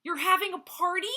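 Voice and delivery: high, loud whine; jealous Jackal whining at Zebra. Acted. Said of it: "whined Jackal".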